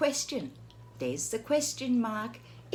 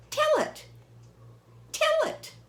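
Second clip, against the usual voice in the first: very high, louder eager plea; begging Piggy; 3 dB louder than usual. Acted. Piggy begs Gerald to tell the story, high and eager. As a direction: bounce it like an impatient piglet.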